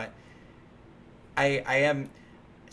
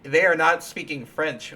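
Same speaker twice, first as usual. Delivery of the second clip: louder insistent objection, a hint of a child; indignant protest; 3 semitones higher, 8 dB louder than usual. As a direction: push the point harder, louder. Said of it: "and I'd be like".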